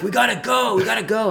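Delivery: rally everybody in a laughing rush; excited rousing call. Retold or imitated. Guide urgently rousing the group.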